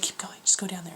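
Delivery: soft whisper; quietly steer her down the street. Retold or imitated. Friend leading Carly away from the studio, whisper.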